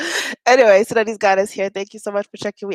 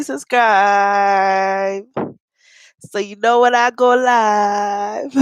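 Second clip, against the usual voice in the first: put-on Jamaican accent; 4 semitones higher, slower than usual. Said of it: "That was the worst Jamaican accent ever".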